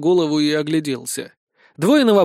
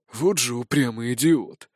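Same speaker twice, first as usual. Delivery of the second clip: put-on grumbling old man; 4 semitones lower, 4 dB quieter than usual.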